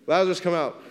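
high, loud call again. Retold or imitated. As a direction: cry it out to the tomb. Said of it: "he was like".

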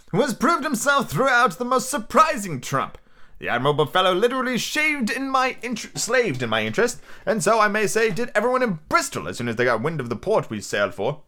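high, theatrical squire voice; excitable letter-writing squire. Acted. Squire writing to his doctor friend about the schooner, excitable. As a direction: read the letter out grandly, swelling on key names.

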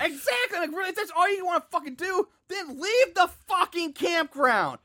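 high, exasperated rant. Real, not acted.

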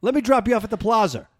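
showing off the local accent with a plaza joke; New England accent. Shown as a demonstration. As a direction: lay on the dropped R and open ah. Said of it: "open-ended ah".

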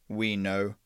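slow, grave broken speech of the alien; alien envoy Griff. A narrator's reading. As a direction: lay out the grievance slowly, like a weighty alien.

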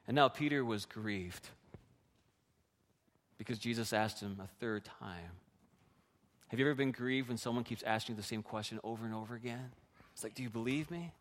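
low, very soft, solemn; hushed sorrow. Real, not acted. Preacher dwelling on Peter being grieved, hushed and solemn. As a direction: drop right down and let the grief settle.

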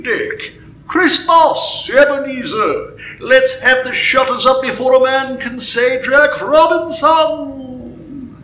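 rich, fat, jovial shout, high and loud. Acted. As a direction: call the boys merrily to close up. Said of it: "comfortable, oily, rich, fat, jovial voice".

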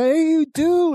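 put-on very high childish wail; mock drunken retort. Drunk brother answering in kind, high mock outrage. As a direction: squeal it back like a drunk in a scuffle.

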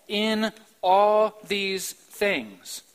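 "all" stretched very slowly and loud; slow drawn-out emphasis on "all"; stress on all. Shown as a demonstration. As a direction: draw out "all" heavily. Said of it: "So not some, but all".

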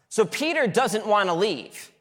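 normal talking voice

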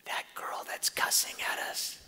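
whispered aside to husband. Retold or imitated. Wife whispering to husband at the clinic, hushed whisper. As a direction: lean in and whisper it. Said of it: "She goes".

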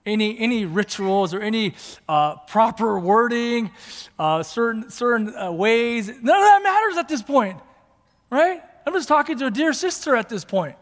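loud, high, climbing sermon peak; fervent preaching. Real, not acted. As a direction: build to an impassioned cry. Preaches at full force.

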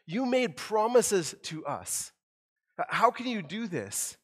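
a prophet begging God to remember his promises; plaintive cry. Retold or imitated. Habakkuk pleading with God, a plaintive mock cry. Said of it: "he gives this plaintive cry".

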